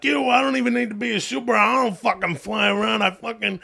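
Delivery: Long Island regular giving his opinion; Long Island accent. Acted. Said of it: "that's a Long Island accent".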